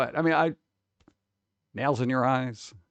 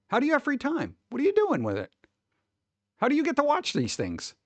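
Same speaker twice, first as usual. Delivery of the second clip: exasperated; 12 semitones higher than usual. Real, not acted. Exasperated questions to chat.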